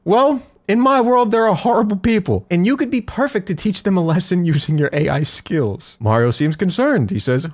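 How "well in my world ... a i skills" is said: much higher scheming voice. Retold or imitated. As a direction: read it high and sly. Velvet's lines, voiced high.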